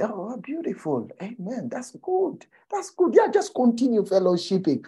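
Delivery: high-pitched, indulgent praise; encouraging. Acted. Mentor encouraging young men in their small revelation, indulgent. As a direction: humour them with lilting approval. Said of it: "But to encourage them".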